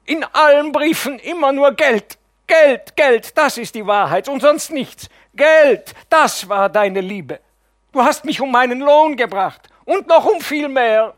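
high, loud, furious outburst; breathless accusation. Acted. Theta accuses the nephew of wanting only money, loud and furious. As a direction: fling it at him gasping. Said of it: "keucht sie".

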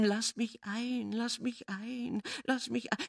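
put-on a ghost child whining at the window; mournful whimper. A narrator's reading. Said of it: "hörte ich das kummervolle Winseln".

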